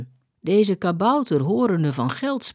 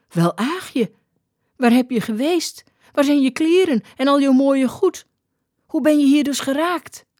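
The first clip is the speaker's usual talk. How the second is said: questions climbing higher in disbelief; astonished; 8 semitones higher than usual. A narrator's reading. Skipper recognising Aagje, astonished high-pitched questioning. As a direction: fire off questions, rising in pitch.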